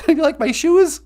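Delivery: giggly puppet voice. Acted. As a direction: squeal it like a flattered puppet. Puppet flattered by compliment.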